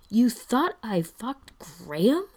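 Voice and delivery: slow, incredulous question swooping high; amused outrage. A narrator's reading. Brendan questions Spencer's wrong assumption, outraged and amused. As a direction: draw it out in disbelief, rising sharply at the end. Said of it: "looks outraged and amused and fond".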